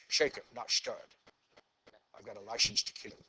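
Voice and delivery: lightly put-on Scottish Bond voice; a teacher's mock Scottish accent that barely comes through; weak Scottish accent. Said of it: "I'm trying to do a Scottish accent".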